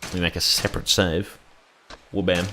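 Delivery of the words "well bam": usual speaking voice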